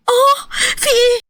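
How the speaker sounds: a high, startled cry of fear; jolted awake and scared at the door; frightened cry. Acted. Girl cries out in fear opening the door to pounding. Woken by loud banging, frightened.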